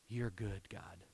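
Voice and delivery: soft, falling, almost breathed; hushed prayer. Acted. Ezra ending his prayer to God, hushed and tender. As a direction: let it fall to a reverent hush.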